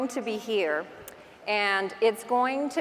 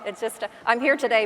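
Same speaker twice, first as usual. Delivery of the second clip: ordinary delivery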